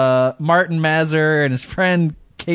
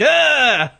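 startled yelp; 11 semitones higher, 4 dB louder than usual. Retold or imitated. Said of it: "they're like".

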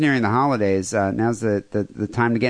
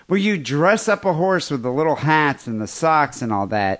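usual speaking voice

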